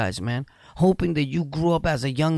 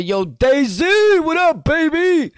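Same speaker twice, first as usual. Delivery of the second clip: hyped flirty call; 12 semitones higher, 6 dB louder, slower than usual. Real, not acted. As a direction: call out to her all fired up.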